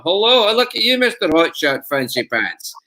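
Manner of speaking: a cheeky Scottish uncle mocking a show-off. Shown as a demonstration. Actor voices the sleazy uncle character, teasing Scottish accent. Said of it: "that kind of uncle".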